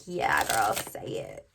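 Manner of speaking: egging on with a swooping put-on voice, slow at the end; cheering card voice. Card energy cheering the reader on, swooping sassy voice. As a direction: hype the reader up like a sassy friend. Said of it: "She's like".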